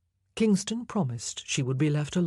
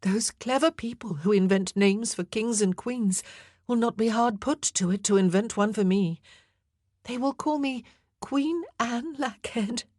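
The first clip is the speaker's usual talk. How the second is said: attempted jest; 7 semitones higher than usual. A narrator's reading. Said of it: "She even attempted a jest".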